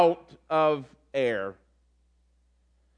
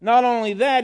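ordinary talk, nothing marked

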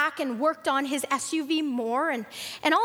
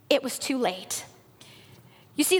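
ordinary delivery

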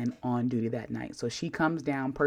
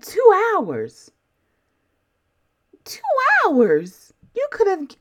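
high, loud incredulous shriek; outraged disbelief; 9 dB louder, slower than usual. Retold or imitated. Patient hearing the wait time, high and outraged. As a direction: repeat it in shocked outrage. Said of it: "I said".

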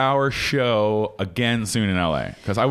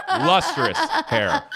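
Lustrous hair drawn out high and slow; high slow silly voice; much slower than usual. Shown as a demonstration.

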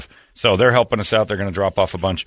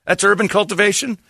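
incredulous; 11 semitones higher than usual. Real, not acted. Mocking incredulous question.

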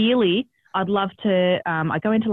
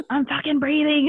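higher, exasperated snap at the midwife; irritated snap; 7 semitones higher than usual. Retold or imitated. Woman in labour snapping at midwife, exasperated. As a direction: bite back that you are breathing. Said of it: "I'll say the, the swearing anyway".

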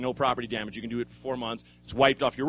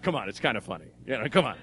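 good cop; 5 semitones lower, faster than usual. Retold or imitated. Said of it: "good cop, bad cop".

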